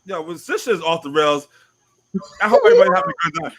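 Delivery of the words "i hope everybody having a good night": fast, high, excited shout-out; hyped host greeting. Real, not acted. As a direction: rush it out, hyped.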